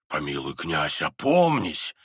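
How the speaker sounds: pleading general. A narrator's reading. The general grabs the prince's arm, low urgent plea. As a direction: urge him in a low voice. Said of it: "говорил он, хватая его за руку".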